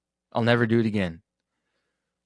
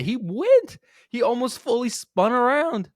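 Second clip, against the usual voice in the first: much higher, louder, incredulous; worked-up outburst; 13 semitones higher, 4 dB louder, slower than usual. Real, not acted. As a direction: blurt it out in disbelief at the call. Ranting about a missed swing call.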